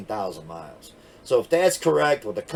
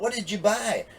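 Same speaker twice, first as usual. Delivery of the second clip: imitated yelling; 8 semitones higher than usual. Wife demanding to know what he bought, mock yelling. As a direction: mimic an exasperated demand in a raised pitch. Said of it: "she'd be yelling out".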